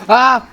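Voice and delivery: sharp startled shout; startled yell. Real, not acted. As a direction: jolt out a scared yell. Shot at in firefight.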